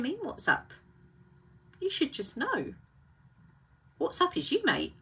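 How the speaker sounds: mock-huffy, exasperated inner voice; indignant silent retort. Sulker silently answers the clueless friend, mock indignant. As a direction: let the imagined reply drip with wounded indignation. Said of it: "give them that look as if to say".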